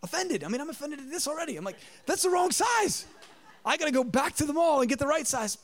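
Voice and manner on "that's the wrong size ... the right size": exasperated. Retold or imitated. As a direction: play up the outrage at the wrong size. Retold exasperation at wife.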